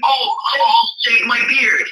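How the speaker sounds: high, slow hammed-up wail; mock wailing. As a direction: wail it like a fake breakdown. Mock desperate offer, crying shout.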